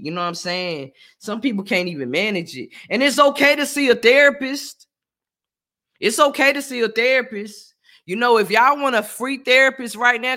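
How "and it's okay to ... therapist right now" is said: louder, higher emphatic pitch; loud sincere urging. Real, not acted. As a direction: hit it hard like a pitch.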